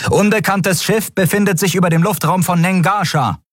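high-pitched, urgent military report; pilot's report. A narrator's reading. Pilot reports the ship's position to his captain, urgent. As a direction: call it out from the console. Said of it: "meldete der Pilot".

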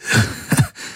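breathy raspy laugh through tears; a worn-out husky chuckle; hoarse laugh. A narrator's reading. Crying man laughs hoarsely in relief. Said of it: "aber er lachte heiser".

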